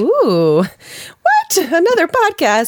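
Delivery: high-pitched mock disbelief; mock shock. Co-host hears of another podcast, mock shock. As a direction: squeal it as if scandalized, in jest.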